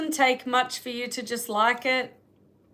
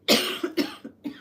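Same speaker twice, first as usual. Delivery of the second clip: harsh coughing fit; choking cough. Real, not acted. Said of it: "I'm choking".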